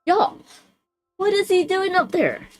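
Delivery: high-pitched exasperation; exasperated. Real, not acted. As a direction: complain to viewers, pitch way up. Exasperated at noise from upstairs.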